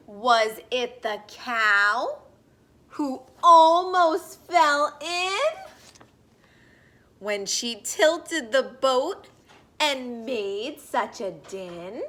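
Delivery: slow, sing-song picture-book read-aloud; gentle storybook voice for little listeners; reading to children. Acted. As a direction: read it like story time on the rug.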